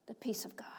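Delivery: hushed whisper. Acted. Preacher quoting Jesus to the congregation, whisper. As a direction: whisper the promise.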